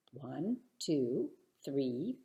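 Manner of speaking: ordinary delivery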